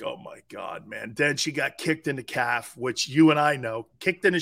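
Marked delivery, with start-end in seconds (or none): 0.0-1.1 near-whispered disbelief under the breath; hushed awe. Retold or imitated. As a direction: breathe it out like you can't believe the photo. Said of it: "I was like".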